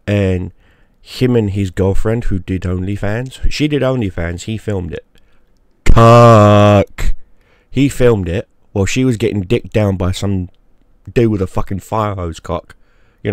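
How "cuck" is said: loud, spat-out insult; contemptuous shout. Real, not acted. Vlogger mocks the podcast guy with a loud scornful insult. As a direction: bark the word with contempt.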